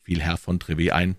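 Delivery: plain reading voice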